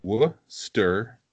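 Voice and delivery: Worcester drawn out slowly as WUS-ter; slow demonstration. Shown as a demonstration. Local teaching the friend, slow careful Worcester. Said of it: "I'm gonna make this easy for you".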